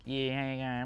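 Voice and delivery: mumbled Aussie yeah, mouth barely open; lazy Australian drawl, lips almost shut; Australian accent. Retold or imitated. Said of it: "Aussies speak like this".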